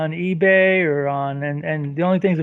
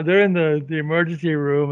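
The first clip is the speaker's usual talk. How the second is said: usual speaking voice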